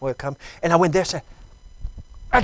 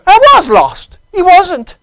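high, booming declaration; emphatic shouting; 12 semitones higher, 15 dB louder, slower than usual. Retold or imitated. Preacher telling congregation who was lost, shouting. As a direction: bellow it to the whole room.

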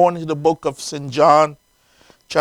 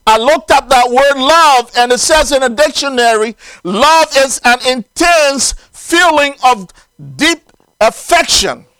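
raised, emphatic preacher's delivery; preaching; 8 semitones higher, 8 dB louder than usual. Acted. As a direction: preach it out with ringing, drawn-out phrase endings. Preaches at full force.

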